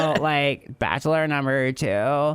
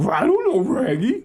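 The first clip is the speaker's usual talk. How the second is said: high, loud cartoon dog voice; Scooby voice; 11 semitones higher, 3 dB louder than usual. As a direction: bark it out like Scooby-Doo. Scooby-Doo style voice.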